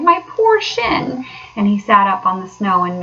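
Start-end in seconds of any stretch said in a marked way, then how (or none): 0.0-1.2 high, slow lament over the shin; pained whimper. A narrator's reading. Mole moaning over his cut shin, pained. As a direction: nurse the hurt in the voice. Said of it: "he cried".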